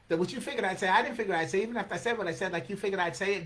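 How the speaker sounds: rapid-fire tongue-twisting verse; rapid recital. Acted. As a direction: rattle off the tangled line in one breath. Fast take, later replayed slowly.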